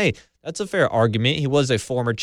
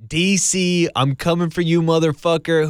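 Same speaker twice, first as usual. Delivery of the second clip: mock callout shout; 5 semitones higher, 5 dB louder than usual. Host echoes Brock's callout.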